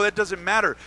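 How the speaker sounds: usual speaking voice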